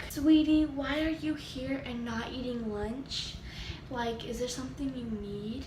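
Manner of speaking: soft, concerned office lady; imitated sweet receptionist; front-office lady. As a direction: gently check on a child, hushed. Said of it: "the front lady with the telephone".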